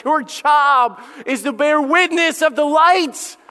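high, slow, ringing voice of God. Acted. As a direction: proclaim it slowly like God speaking. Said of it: "and God says".

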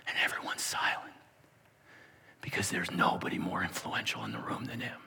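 whispered, breathy hush. Acted. Preacher describing the silent courtroom in a whisper. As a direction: whisper as the room falls silent. Narrating a hushed room.

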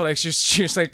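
ordinary delivery

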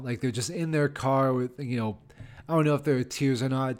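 usual speaking voice

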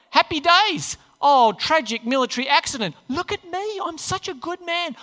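high, hammed-up smug cheer; mock self-congratulation. Mimics David congratulating himself.

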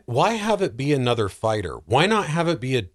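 ordinary talk

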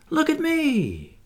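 very high and slow, a drawn-out squeal; Wendy at the peak of delight, stretched high and slow; ecstatic squeal. A narrator's reading. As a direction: reach the top of your voice in glee. Wendy calling while flying.

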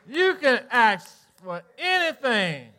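slow, emphatic preaching declaration; preaching emphasis. Real, not acted. Preacher assuring his congregation, slow and emphatic. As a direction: proclaim it slowly to the room. Sermon punchline.

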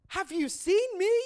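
high, loud, look at me; indignant boast. Acted. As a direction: worker demanding credit. Big worker boasts of work.

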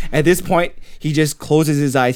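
usual speaking voice

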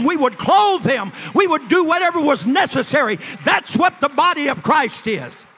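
loud, high, fervent preaching, slowing on key lines; preaching at full force. Acted. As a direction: build the church into a roaring appeal. Preaching climax to the congregation.

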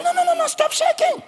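high, pleading no's from the king; urgent gentle king. Acted. King urges the trembling boy, high and pleading. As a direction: lift him up with high urgent reassurance.

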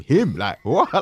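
loud, high laughing what; aghast and half laughing; disbelief. Retold or imitated. Police told he's inside with him.

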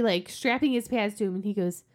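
ordinary delivery

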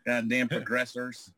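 usual speaking voice

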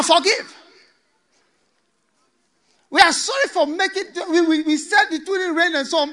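loud, high mock pleading with laughs; the drowning crowd pleads with Noah, loud and high. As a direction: beg Noah to open the door. People beg Noah.

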